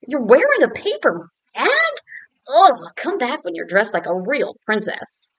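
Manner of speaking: loud, disdainful prince scolding; snooty prince. Acted. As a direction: look down your nose at her. Snobby prince scolds Elizabeth.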